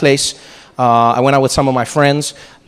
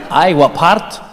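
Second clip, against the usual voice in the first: Scottish shopkeeper; much slower than usual. Retold or imitated.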